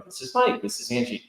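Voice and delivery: high-pitched mock announcing of each author; mock book narration. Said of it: "books where they go".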